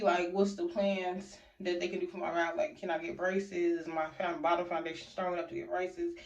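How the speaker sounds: usual speaking voice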